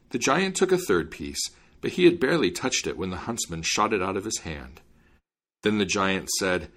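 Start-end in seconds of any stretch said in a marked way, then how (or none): none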